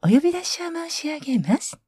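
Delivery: polite department store PA call; store announcer voice. Shown as a demonstration. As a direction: announce it over the store speakers. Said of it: "呼んでほしい？".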